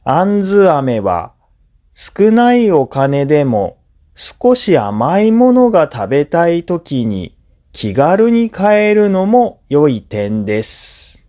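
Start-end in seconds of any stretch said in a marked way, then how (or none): none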